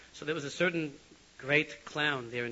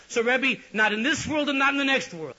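high, loud, pleading cry; fervent shout; 12 semitones higher, 12 dB louder than usual. Retold or imitated. Yitzchak ends his rebuke of the Rebbe, loud and high. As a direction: hit the Rebbe with it full force.

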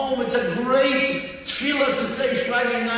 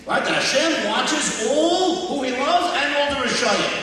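normal talking voice

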